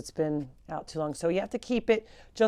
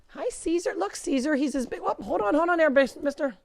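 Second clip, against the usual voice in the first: high, louder cooing to pets; bright pet-talk coo; 8 semitones higher, 4 dB louder than usual. Real, not acted. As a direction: coax the animals sweetly. Cooing at the animals.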